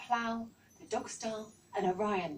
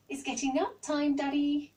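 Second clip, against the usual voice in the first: excited little owl shouting, higher and louder; 8 semitones higher, 6 dB louder than usual. A narrator's reading. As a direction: call out eagerly to his dad. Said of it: "he shouted".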